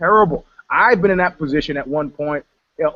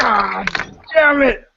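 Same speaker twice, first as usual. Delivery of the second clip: anguished curse; 6 semitones higher, much slower than usual. Retold or imitated. Hearing she's getting married.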